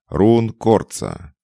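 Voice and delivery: slow, loud, high taunting call; spiteful call. A narrator's reading. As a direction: draw the name out with malice. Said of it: "злобные нотки в голосе".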